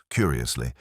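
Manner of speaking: plain reading voice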